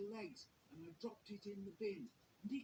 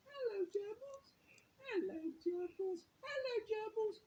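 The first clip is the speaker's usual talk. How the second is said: put-on very high, cooing little-boy voice; toddler voice; 6 dB louder, slower than usual. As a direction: coo it like a delighted two-year-old.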